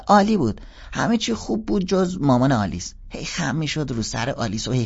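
put-on high, bright-eyed kid sister confessing; little girl voice. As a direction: play the young sister, pitched well up. (صدای دختر بچه، زیر و بلند)

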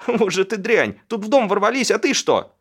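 high, loud reproach; angry scolding. A narrator's reading. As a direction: lay into your useless husband. Woman scolds her husband. (сварливый крик на мужа)

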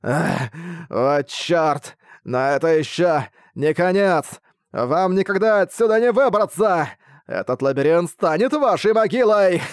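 defiant dying monster, then cackle; booming arrogant alien overlord. Acted. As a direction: curse them with his last breath. Dying boss last speech.

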